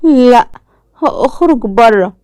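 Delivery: put-on high frightened child's protest; scared child. A narrator's reading. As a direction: pull back in fear, voice up. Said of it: "إياد بخوف".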